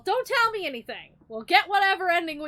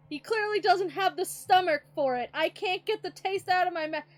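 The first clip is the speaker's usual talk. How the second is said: ordinary talk, nothing marked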